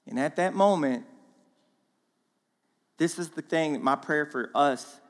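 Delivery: ordinary talk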